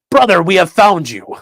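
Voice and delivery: mock berserker greeting. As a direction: hail him as a long-lost brother warrior.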